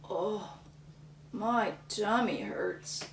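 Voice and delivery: slow, soft groaning complaint; tummy ache. Acted. Lumberjack groaning after eating too much, soft and slow. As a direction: moan it weakly. Sick worker.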